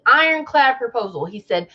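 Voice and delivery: ordinary talk, nothing marked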